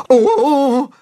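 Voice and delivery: high startled "oh"; losing balance; wobbly gasp. Acted. Wobbling on goat's back.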